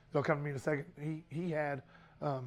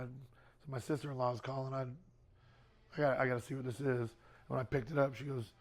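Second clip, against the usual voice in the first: ordinary talk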